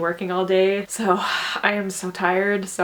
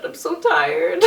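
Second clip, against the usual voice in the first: higher, laughing through the words; laughing tired; 7 semitones higher, 4 dB louder, slower than usual. Real, not acted. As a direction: giggle out the exhaustion. Exhausted relief after submitting.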